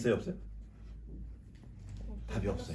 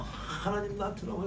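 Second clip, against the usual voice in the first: petulant complaint; 7 semitones higher, 7 dB quieter than usual. Retold or imitated. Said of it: "막 또 승질을 부리면서".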